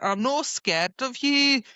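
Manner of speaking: high, slow mock defiance; retold defiance. Imitating her standing up to him, mock defiance. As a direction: draw it out high, play-acting bravado. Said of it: "She's like".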